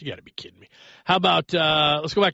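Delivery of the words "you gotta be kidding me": muttered disbelief. Real, not acted. Caller dropped off.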